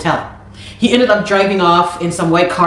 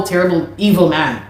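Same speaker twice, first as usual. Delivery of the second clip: ordinary talk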